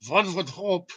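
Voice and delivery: retry the Einstein voice, slowly. Pretend. To viewers, second try at Einstein's German voice. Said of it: "sounds more like the German guy".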